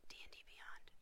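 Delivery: whisper. Real, not acted.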